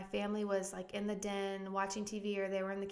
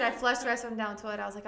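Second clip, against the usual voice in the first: ordinary talk, nothing marked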